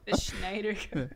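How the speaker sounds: goofy "Schneider" with a mushy "sh" after laughing; silly pronunciation. Pretend. Amusing friends with the topic, silly "Schneider". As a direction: savor "Schneider" as a silly word. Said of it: "I just like saying that".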